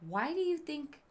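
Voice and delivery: ordinary delivery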